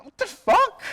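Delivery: high, loud cry of disbelief; shocked outburst. Retold or imitated. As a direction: burst out in retold shock. Acting out their shocked reaction.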